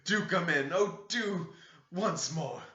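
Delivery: high, slow, tearful begging; sobbing plea. Acted. Heathcliff calling Catherine's ghost in, slow high sobbing. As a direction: plead through sobs, dragging the words. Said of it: "he sobbed".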